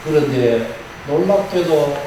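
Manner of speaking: usual speaking voice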